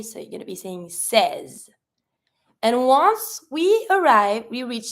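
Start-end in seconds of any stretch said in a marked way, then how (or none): 0.9-1.7 French seize with eh vowel, one syllable; silent final E. Shown as a demonstration. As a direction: seize said "sez", ending on z. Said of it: "don't pronounce the final E".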